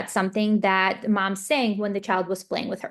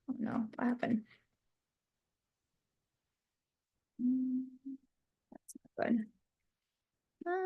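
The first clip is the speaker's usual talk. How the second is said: softer whispered muttering to herself; whispered aside; 4 semitones higher, 12 dB quieter than usual. Real, not acted. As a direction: whisper it off to the side. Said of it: "I lost it there because of the annotation".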